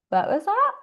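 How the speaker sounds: high-pitched, mock-startled question. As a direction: act out suddenly noticing a noise, pitch jumping up. Acting out hearing a noise.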